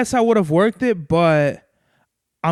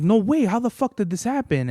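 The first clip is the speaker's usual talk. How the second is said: shocked; 5 semitones higher than usual. Retold or imitated. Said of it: "I was just shocked".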